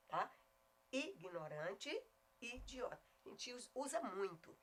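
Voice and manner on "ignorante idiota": IGNORANTE and IDIOTA softly, each with a pure oral I; correct pronunciation. Shown as a demonstration. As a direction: separate the clean first I in IGNORANTE and IDIOTA. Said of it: "É ignorante".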